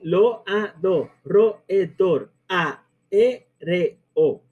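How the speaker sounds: loado, roedor, aéreo chanted syllable by syllable; split into syllables. Shown as a demonstration. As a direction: count out each syllable on its own. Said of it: "esdrújula por hiato".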